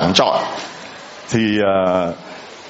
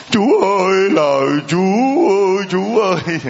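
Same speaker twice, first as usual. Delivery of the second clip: mock wailing mourner; 10 semitones higher than usual. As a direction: ham up a paid wail for a dead uncle. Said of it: "Rú lên".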